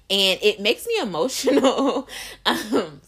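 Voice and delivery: real choked-up laugh; emotional laugh. Real, not acted. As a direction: laugh through rising emotion. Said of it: "I'm getting emotional talking about it".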